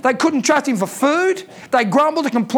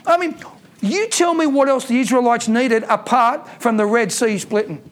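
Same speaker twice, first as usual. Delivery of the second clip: ordinary talk, nothing marked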